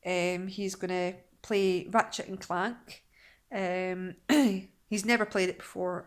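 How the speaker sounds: ordinary delivery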